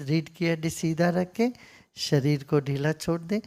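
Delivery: low, soft voice easing into meditation; guided meditation. Real, not acted. Settles listeners into meditation.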